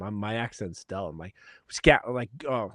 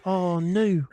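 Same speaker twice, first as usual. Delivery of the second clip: put-on higher, louder Kiwi slang accent with a laugh; 10 semitones higher, 5 dB louder, slower than usual. Host praising the beer in a mock Kiwi accent. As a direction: lay on the Kiwi accent, delighted. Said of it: "in a Kiwi accent".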